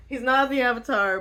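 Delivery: usual speaking voice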